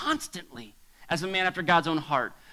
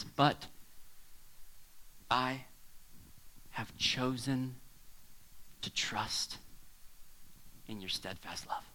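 slow, soft, sinking to a whisper on trust; hushed reverent reading; 6 semitones lower, 9 dB quieter, slower than usual. Real, not acted. Preacher reading David's trust verse to church, hushed and slow. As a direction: let each word fall quietly, slowly.

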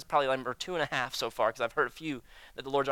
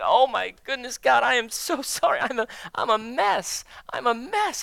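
contrite confession; 7 semitones higher, 4 dB louder than usual. Retold or imitated. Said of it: "And you're like".

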